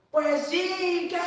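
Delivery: loud, high defensive snap; provoked retort. Retold or imitated. Provoked believer snapping back, loud and high.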